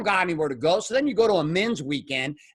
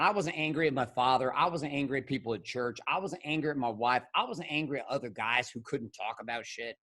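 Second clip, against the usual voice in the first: quiet confession; 7 semitones lower, 8 dB quieter than usual. Real, not acted. Confession after rage.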